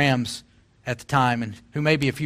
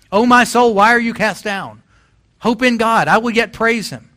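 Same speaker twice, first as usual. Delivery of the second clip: higher and louder, stirring psalm cry; fervent preaching; 8 semitones higher, 6 dB louder than usual. Acted. Preacher crying the psalm to his own soul, loud and high. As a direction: lift the congregation with it.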